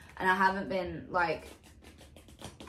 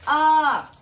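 surprised ah; 6 semitones higher, 11 dB louder than usual. Real, not acted. Surprised exclamation.

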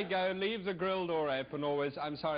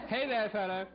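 higher, grander lordly hail; better duke voice; 5 semitones higher than usual. Acted. Duke of Parma hails a little chap in his vineyard, higher and grand. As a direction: call out like a duke to a peasant. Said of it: "That's better".

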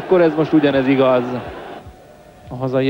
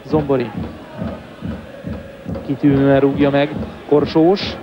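ordinary talk, nothing marked

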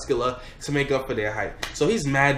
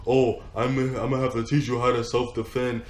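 dad voice; 3 semitones lower than usual. Retold or imitated. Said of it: "He's like".

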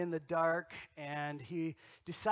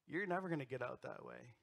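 spooky voice in the dark; 5 semitones lower, 9 dB quieter, faster than usual. Retold or imitated. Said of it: "This voice".

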